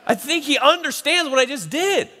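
father laughing with joy as his son kisses him; laughing in wonder. Retold or imitated. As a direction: laugh with delighted disbelief at the baby. Moved father retelling baby's kiss.

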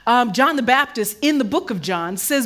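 fired-up sermon; preaching. Acted. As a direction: preach it out big to the room. Preaching at full force.

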